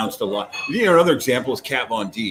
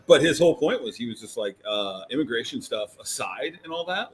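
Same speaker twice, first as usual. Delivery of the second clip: normal talking voice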